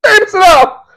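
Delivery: high, laughing cry of shock; laughing disbelief. Real, not acted. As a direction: squeal through laughter at the ad.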